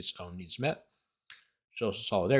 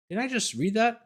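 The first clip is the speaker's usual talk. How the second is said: disbelief; 11 semitones higher than usual. Real, not acted. Disbelief at own reading.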